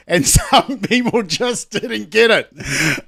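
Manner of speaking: laughing through the words; slow, amused chuckling. Real, not acted. Amused at people missing joke.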